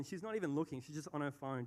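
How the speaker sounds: usual speaking voice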